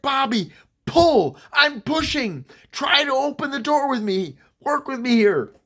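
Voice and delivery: high, strained, emphatic commands; panicked urging. Retold or imitated. Kid pushing a stuck door to free friend, panicked. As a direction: urge him to work with you, pitched right up.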